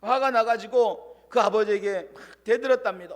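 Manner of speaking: ordinary delivery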